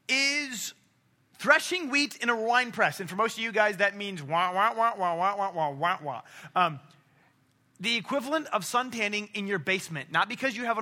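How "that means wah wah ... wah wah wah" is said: mock sad trombone. Mocking sad trombone sound.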